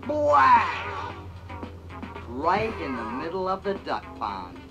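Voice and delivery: usual speaking voice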